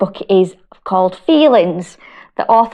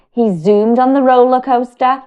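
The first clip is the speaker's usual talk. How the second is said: put-on reading to children; 3 semitones higher than usual. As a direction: draw little listeners into the story. Reads picture book to children.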